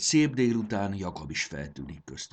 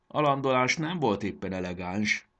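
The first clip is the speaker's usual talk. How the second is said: ordinary delivery, nothing marked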